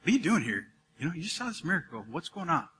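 soft, conversational voice of God; gentle, casual probing. Retold or imitated. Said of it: "God kinda said it like that".

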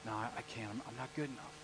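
meek, quiet Moses; timid Moses. Retold or imitated. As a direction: shrink back in doubt. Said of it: "And Moses is like".

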